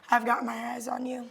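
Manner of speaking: hushed, hurried warning; quiet threat. Acted. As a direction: slip the warning out fast and soft. First of escalating threats.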